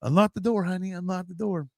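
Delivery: lightly voiced sheriff coaxing the little girl. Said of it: "telling Michaela".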